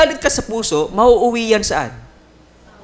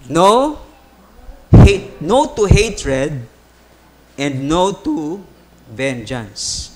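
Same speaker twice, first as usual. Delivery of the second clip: slow emphatic; 5 semitones lower, much slower than usual. Real, not acted. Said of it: "slow emphatic lesson point".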